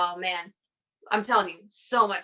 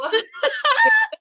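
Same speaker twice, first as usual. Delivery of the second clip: a sudden high burst of laughter; 9 dB louder than usual. Real, not acted. Asked about her good news, high embarrassed laugh.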